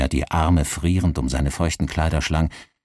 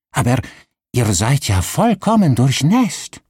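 astonished innkeeper; 8 semitones higher, 5 dB louder than usual. Acted. Innkeeper exclaims at soaked guest. (erstaunter Ausruf, hoch und laut)

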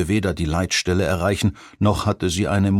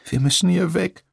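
put-on much higher, whiny plea of a frightened girl; whining; 12 semitones higher than usual. A narrator's reading. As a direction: whimper it in panic. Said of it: "jammerte November".

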